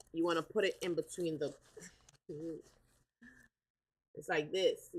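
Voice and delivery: usual speaking voice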